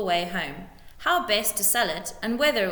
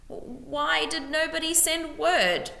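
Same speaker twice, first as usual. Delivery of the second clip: slow, high sobbing question; sobbed; 9 semitones higher, slower than usual. A narrator's reading. Widow sobbing at the lord over her husband. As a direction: grieve aloud as she asks. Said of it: "sobbed Missus Beamish".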